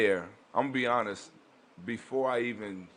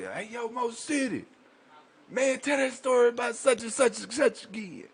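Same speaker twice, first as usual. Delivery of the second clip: imitated yell; 14 semitones higher than usual. Inmate calling from a cell for a story, yelled. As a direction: call out for a story, stretched and high. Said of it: "somebody yell out to say".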